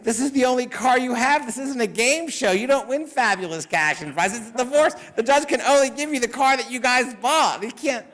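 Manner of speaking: high, incredulous reenacted rebuke; exasperated retort. Lawyer to client about the divorce car, exasperated.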